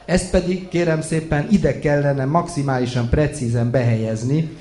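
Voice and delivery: ordinary delivery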